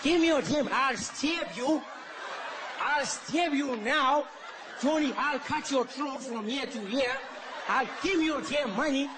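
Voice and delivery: a hammed-up Indian accent on a half-hearted mugging threat; mock Indian robber. Indian guy robbing someone, mild mock Indian accent. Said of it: "can you imagine getting robbed by an Indian guy".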